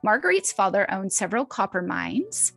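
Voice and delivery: normal talking voice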